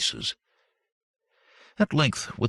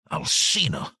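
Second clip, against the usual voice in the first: a breathy startled cry of her name; surprised cry; 8 semitones higher than usual. A narrator's reading. Wizard startled as his servant staggers in, breathy cry. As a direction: gasp her name out in shock. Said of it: "cried the wizard".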